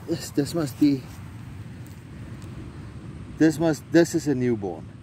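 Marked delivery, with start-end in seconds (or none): none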